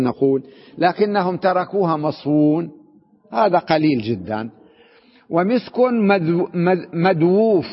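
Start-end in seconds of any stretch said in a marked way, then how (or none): 5.3-7.7 مدووف said slowly with a doubled و; halting, slow reading of مدووف; slow reading of a rare form. Shown as a demonstration. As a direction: spell out مدووف carefully. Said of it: "يعني مخلوط".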